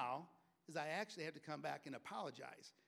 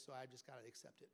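mock resigned; 2 semitones lower, 7 dB quieter, faster than usual. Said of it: "never in your pride go".

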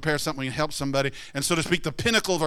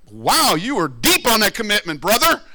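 sarcastic jab; 6 semitones higher, 8 dB louder than usual. Acted. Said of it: "sarcastic reply to quitter".

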